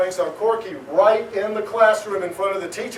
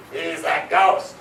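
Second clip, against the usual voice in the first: put-on slow, lower, grumpy old elf voice; deep raspy nasally voice; 4 semitones lower, slower than usual. Old elf in the tree scoffs at the boy, in a deep grumbling voice. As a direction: growl it like a cranky tiny old elf. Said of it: "a deep, raspy, nasally voice".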